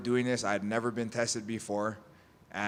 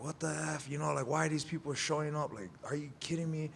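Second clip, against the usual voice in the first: retold pissed-off grumbling; 5 semitones higher than usual. Athlete cussing behind the door, retold annoyance in higher pitch. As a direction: complain in disbelief, held down in volume. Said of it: "I'm pissed".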